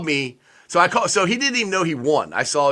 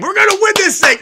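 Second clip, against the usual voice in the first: loud, high hyped-up fan cry; rally like a fan sure of a win; fan hype shout; 7 dB louder than usual. Retold or imitated. A Bengals fan mid-game, shouting high and hyped.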